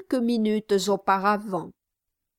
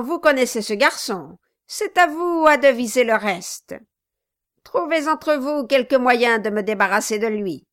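Quilp the dwarf, venomous; 3 semitones higher, 6 dB louder than usual. Acted. Quilp denouncing Kit to the Brasses, louder spite. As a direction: pile the insults on with contempt.

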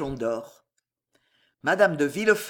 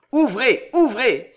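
loud, high, urgent command; anguished cry; 5 semitones higher, 7 dB louder, slower than usual. A narrator's reading. Husband orders his wife to open up, crying out in anguish. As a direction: demand entry, crying out in anguish. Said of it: "s'écria Villefort".

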